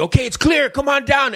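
high sing-song call down the hill; kid calling out. Retold or imitated. Kid at the bottom calling the rider down.

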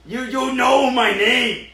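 loud, slow, hammed-up menace; mock villain. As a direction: play a madman villain for laughs. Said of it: "we're taking this bit too far".

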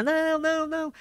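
high desperate pleading cry; staging a scene. Retold or imitated. Faking despair for the prank camera, high pleading cry. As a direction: act out the despair at the gate. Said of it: "Fazendo uma cena".

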